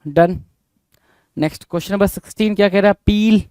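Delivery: ordinary talk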